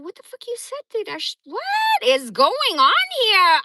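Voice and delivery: high, loud, slowed-down outraged yell; outraged disbelief. Retold or imitated. Confronting her mom who suddenly dislikes him, high outraged yell. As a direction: demand answers, voice shooting way up.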